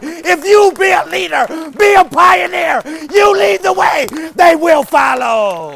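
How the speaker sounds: shouted, high-pitched preaching climax; roaring revival preacher; full force preaching. Acted. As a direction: preach it at the top of your lungs.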